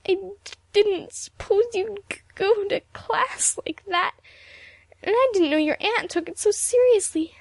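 high, stammering tearful excuses. A narrator's reading. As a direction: blurt excuses in a sobbing, halting voice. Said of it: "looked for a dry spot on her handkerchief".

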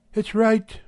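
a hint of a brisk housewife; Mrs Radford's voice. A narrator's reading.

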